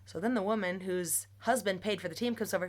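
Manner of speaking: ordinary talk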